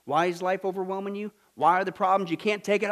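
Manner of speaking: normal talking voice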